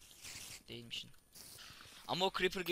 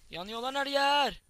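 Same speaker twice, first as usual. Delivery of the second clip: alarmed yell; 9 semitones higher, 7 dB louder, slower than usual. Real, not acted. Panicking while burning in game.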